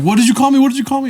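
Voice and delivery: high-pitched imitated demand; mock confrontation. Co-host replaying friend's showdown, mock-indignant. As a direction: parody an indignant challenge.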